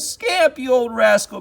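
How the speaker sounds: put-on high, delighted boyish shout; boy tumbling in the grass with his dog; joyful cry. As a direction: cry out with glee. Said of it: "cried Orvie".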